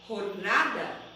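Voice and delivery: "jornada" opening with a rasped h, hor-NA-da; J sounds like R. Shown as a demonstration. Teacher shows students the Spanish J in "jornada". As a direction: give the J in "jornada" a throaty h sound. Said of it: "em espanhol esse J tem som de R".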